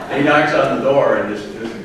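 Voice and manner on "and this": ordinary talk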